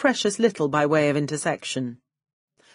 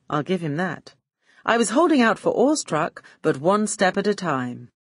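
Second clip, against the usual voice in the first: plain reading voice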